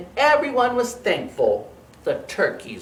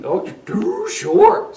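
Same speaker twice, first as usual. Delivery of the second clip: high, loud, slow cow voice; 13 semitones higher, 6 dB louder, slower than usual. Acted. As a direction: tease like a silly cow. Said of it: "said the cow".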